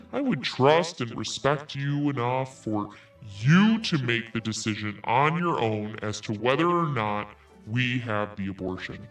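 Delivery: ordinary delivery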